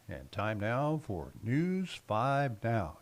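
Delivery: slow, booming announcer delivery. Shown as a demonstration. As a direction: stretch it out like a TV announcer. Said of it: "I did that in my best announcer voice".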